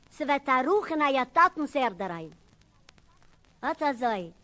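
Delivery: ordinary delivery, nothing marked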